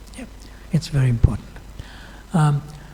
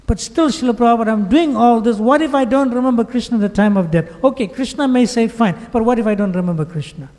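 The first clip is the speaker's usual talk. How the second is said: higher, rushed and fretful; worried disciple repeating his fear to guru, higher and rushed; anxious pleading disciple; 8 semitones higher, 3 dB louder than usual. Retold or imitated. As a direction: press the worry again, faster.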